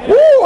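high, loud "woo"; exultant whoop. Real, not acted. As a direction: let out a piercing whoop of joy. Preacher overcome with excitement.

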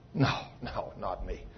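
near-whispered no, no, not me; hushed denial. Retold or imitated. Believer recoiling from the Lord's verdict, hushed whispered denial. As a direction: breathe the refusal under your voice. Preacher voicing a frightened believer.

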